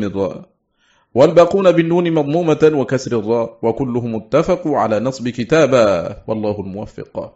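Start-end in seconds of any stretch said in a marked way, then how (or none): none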